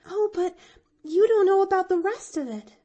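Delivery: put-on high, loud and quick little girl; girl eagerly reminding her friend; eager reminder. A narrator's reading. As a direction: rush in with eagerness. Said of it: "she reminded him eagerly".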